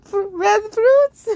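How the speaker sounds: high, slow, hammed-up wail; a hammed-up dying screech over red fruits; mock screech. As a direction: wail it like a cartoon villain. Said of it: "He let out an unholy screech".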